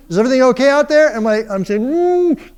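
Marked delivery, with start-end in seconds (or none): 0.1-1.1 higher, louder, fast worried call; mom calling louder. Retold or imitated. Mom calls again, louder. 1.8-2.6 high muffled yell behind a hand; muffled cry. Retold or imitated. My cousin takes his hand and.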